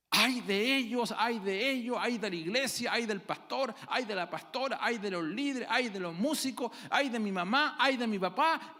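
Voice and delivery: high, loud whiny wail of blame; preacher mimicking a churchgoer who blames everyone else; mock complaining lament. Mocking a blaming churchgoer.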